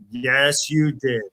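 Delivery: ordinary delivery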